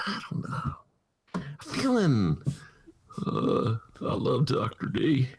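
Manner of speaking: slow, breathy Bill Clinton voice; folksy Bill Clinton imitation; Bill Clinton impression. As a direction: drawl it slowly like Bill Clinton. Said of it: "That's Bill Clinton".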